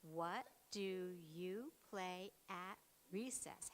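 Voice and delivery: "What do you play at recess?" said slowly, each word set apart to count. Shown as a demonstration. Teacher counting words with the class, slow and separated. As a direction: space out every word for counting. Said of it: "we'll count the words".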